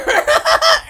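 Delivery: loud high cackle; laughing. Real, not acted. Streamer amused by own imitation, loud cackle.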